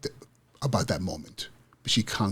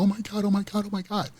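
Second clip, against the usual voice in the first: imitating an ecstatic runner, much higher; 11 semitones higher than usual. Speaker voicing the fourth-place runner's joy, high and ecstatic. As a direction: squeal it the way she cried with joy. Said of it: "She was so ecstatic".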